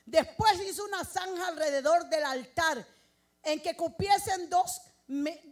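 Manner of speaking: loud preacher proclaiming the story; full-force preaching. Acted. Preacher retelling Elijah's altar to the church, full-force preaching.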